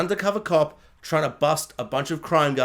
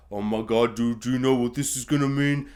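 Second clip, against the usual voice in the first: lightly voiced low tough guy; low, half-hearted movie-star impression; Vin Diesel; 7 semitones lower than usual. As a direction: lower your pitch for a mock action hero. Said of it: "Vin Diesel's like".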